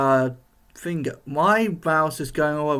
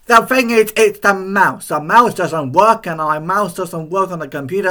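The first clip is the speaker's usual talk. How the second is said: annoyed; 3 semitones higher, 8 dB louder than usual. Real, not acted. Frustrated about broken mouse.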